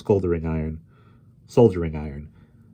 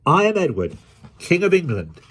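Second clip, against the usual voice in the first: high-pitched royal proclamation; king's declaration; 10 semitones higher, 4 dB louder than usual. A narrator's reading. Boy king declares his title to the crew, high proclamation. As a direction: proclaim it high, each phrase falling at the end.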